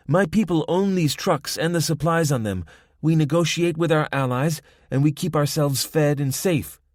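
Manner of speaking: lightly voiced Spanish accent. A narrator's reading. Young woman lecturing.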